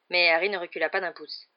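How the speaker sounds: usual speaking voice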